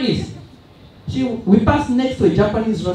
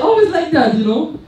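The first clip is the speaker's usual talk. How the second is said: normal talking voice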